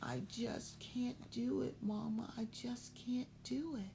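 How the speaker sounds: whispered, high and soft, defeated; small sad whisper. A narrator's reading. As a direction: breathe it out like a scared little bird. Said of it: "feeling sick and sad and saying".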